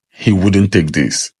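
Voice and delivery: ordinary delivery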